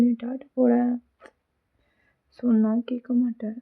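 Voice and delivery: ordinary delivery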